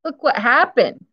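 plain reading voice

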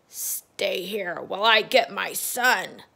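slow, loud commanding toad mother; demanding toad. Acted. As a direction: order her to stay like a bossy toad. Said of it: "demanded the toad".

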